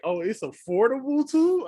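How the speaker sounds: happy discovery of a cheap ticket; retold delighted surprise. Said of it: "'Cause I was like".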